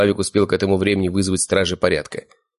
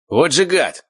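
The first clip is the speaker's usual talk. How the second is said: higher-pitched angry exclamation; mildly raised, heated outburst; indignant shout; 9 semitones higher, 4 dB louder, slower than usual. A narrator's reading. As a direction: cry out in indignation. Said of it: "выкрикнул Славик".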